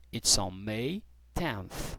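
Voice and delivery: slow, soft, careful answer; slow model answer. Shown as a demonstration. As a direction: say it slowly for learners. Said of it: "Como que você vai falar?".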